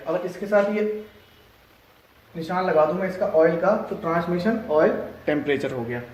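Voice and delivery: ordinary delivery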